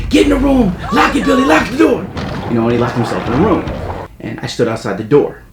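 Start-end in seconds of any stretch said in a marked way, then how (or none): 0.1-2.1 high, loud urgent shout; imitated shrieked warning to a child; mom screaming. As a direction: yell orders like a frantic mother. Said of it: "my mom just screaming".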